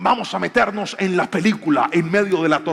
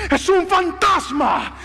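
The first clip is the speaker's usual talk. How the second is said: high, drawn-out cry of alarm; acted panicked shout, slow and high; terrified shout; 11 semitones higher, much slower than usual. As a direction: scream it like frightened men at sea. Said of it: "ellos gritan y dicen".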